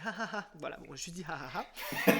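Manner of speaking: soft, token "ha ha"; understated re-enacted chuckle; imitated polite laugh. Host retelling his reaction to the cashier's joke, polite laugh. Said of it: "je rigole, je rigole".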